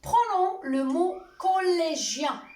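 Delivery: ordinary talk, nothing marked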